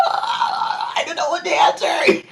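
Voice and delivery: very high panicked squeal; mock panic. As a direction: squeal as if put on the spot. Retold panic at volunteer's question.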